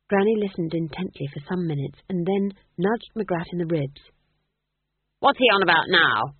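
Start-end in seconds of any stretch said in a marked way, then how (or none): 5.2-6.4 loud, irritated demand; impatient old witch, voice raised. A narrator's reading. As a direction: demand an explanation, loudly. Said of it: "she demanded".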